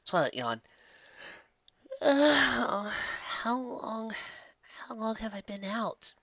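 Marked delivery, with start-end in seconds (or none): 2.0-6.1 groggy high whisper. Acted. Twilight just woken.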